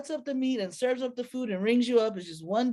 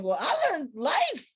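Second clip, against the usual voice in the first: sassy retort; 6 semitones higher than usual. Retold or imitated. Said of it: "I was like".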